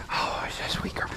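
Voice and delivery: breathy whispered cooing; whispering. Real, not acted. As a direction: whisper it close to the goat. Cooing to a goat.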